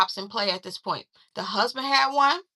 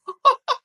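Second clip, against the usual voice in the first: short high burst of laughter; 5 dB louder than usual. Real, not acted. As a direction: laugh at your own joke. Laughs at own joke.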